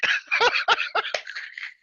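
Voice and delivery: high, wheezy burst of laughter; helpless high laugh; real laughter. Real, not acted. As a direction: crack up at your own joke. Laughs at own joke about accents.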